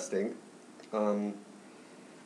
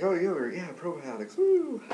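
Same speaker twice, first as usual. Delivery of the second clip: mock excitement; 10 semitones higher than usual. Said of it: "so you might think".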